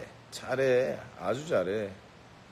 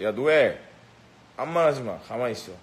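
mock angry yell; 6 dB louder than usual. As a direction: yell him down like a gang boss. Yelling at Noel to shut up. (버럭 소리치며 호통)

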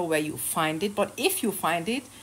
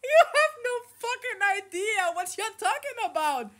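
mocking voice; 12 semitones higher than usual. Mocking imagined tough commenter.

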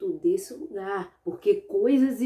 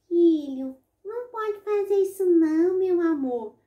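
sweet mother voice; 8 semitones higher, 4 dB louder than usual. Acted. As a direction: coax the child like a loving mother. (mãe carinhosa, voz aguda e doce)

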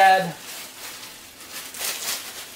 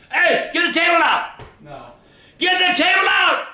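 angry shout; 7 semitones higher than usual. Real, not acted. Son ordering grandpa to take the table out, shouting. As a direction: shout it at him again and again.

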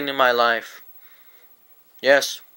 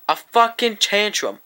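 ordinary talk, nothing marked

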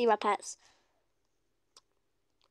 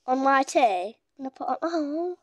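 usual speaking voice

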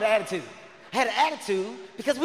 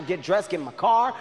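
usual speaking voice

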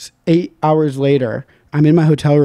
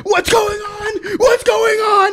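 high, slow crying shout; high tearful panicked shout; much slower than usual. Retold or imitated. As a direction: wail it, lost and scared. Waking in a police chase.

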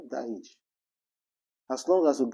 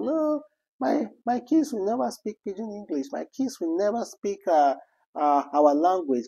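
higher-pitched mock of a proud parent; mocking snobbish parent; 7 semitones higher than usual. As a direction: imitate the snob refusing Pidgin, pitch raised. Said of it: "I've seen some people".

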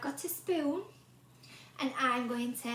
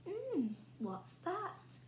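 high, soft curious sniff; mm, savour the smell like a hungry boy; greedy boy sniffing; 7 semitones higher, 8 dB quieter, slower than usual. A narrator's reading. Greedy boy smelling porridge, high and hushed.

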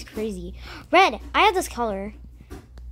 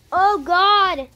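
startled gasp; 6 semitones higher, 7 dB louder than usual. Real, not acted. Another scare.

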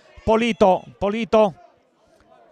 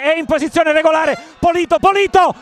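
rising shout, higher and louder; excited commentary; 5 semitones higher, 3 dB louder than usual. Real, not acted. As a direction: call the big save at full voice. Near miss in live commentary.

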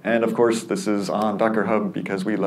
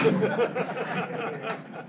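laugh; 13 semitones higher, 7 dB quieter than usual. Real, not acted. Audience laughs at terrible joke.

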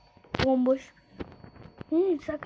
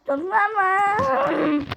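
"Türk var mı" drawn out slowly, higher; slow stretched call; 5 semitones higher, much slower than usual. Shown as a demonstration. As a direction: stretch out "Türk var mı" like calling across a room. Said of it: "Türk var mı yazayım".

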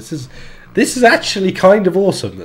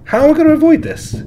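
panicked; 10 semitones higher, 4 dB louder, faster than usual. Real, not acted. Panicking at animatronics.

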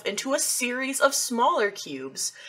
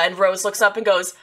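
ordinary talk

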